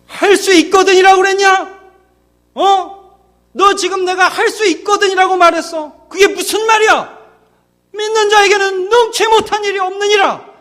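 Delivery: sharp, high and loud, scolding the doubter; stern rebuke. Pretend. Jesus scolding the doubting father, high and loud. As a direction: snap back at the father's doubt. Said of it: "이렇게 무섭게 하셨겠어요".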